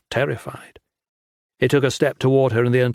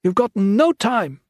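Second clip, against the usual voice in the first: urgent bellow; 11 semitones higher, slower than usual. A narrator's reading. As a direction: order him out at full voice. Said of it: "Lin bellowed".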